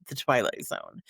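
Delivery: The Twilight Zone dropped low and soft, TWILIGHT leaned on, falling; ominous title read. Shown as a demonstration. Host intoning the show's name for viewers, low and ominous. Title repeated for dramatic effect.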